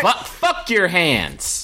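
high, slow, drawn-out refusal; mock defiance. Caesar's comeback to an attacker on stage, mock defiant. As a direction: stretch it out as a comic defiant comeback. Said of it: "Like, "Nope".

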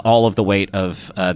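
ordinary delivery

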